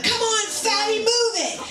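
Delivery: high, teasing workout bark; gym buddy taunt. Retold or imitated. Mom friend at turbo kick teasing, high and peppy. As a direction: push her friend like a peppy trainer. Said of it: "usually she's like".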